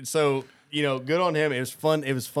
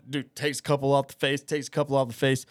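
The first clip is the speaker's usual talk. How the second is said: ordinary talk, nothing marked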